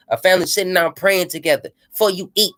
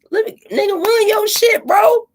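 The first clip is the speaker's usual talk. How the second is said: high, whiny begging voice; pitched-up mocking voice, louder at the end; mock pleading friend; 12 semitones higher, 3 dB louder than usual. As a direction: whine it like a moocher asking favors.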